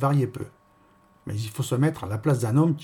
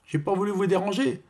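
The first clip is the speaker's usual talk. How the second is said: high-pitched gossiping woman; chatty concierge; 7 semitones higher than usual. Acted. Concierge chatting to her tenant about the fog, high-pitched.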